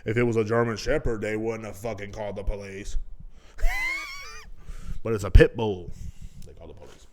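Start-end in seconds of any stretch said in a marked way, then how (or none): 3.0-5.0 sudden high shriek of a laugh. Real, not acted. Laughing at his own joke about the pit bull, shrieking. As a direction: burst out in a squealing cackle.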